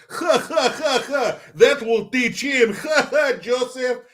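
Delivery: high, cackling gloat while laughing; villain laugh. Retold or imitated. Russian guys sneak out laughing.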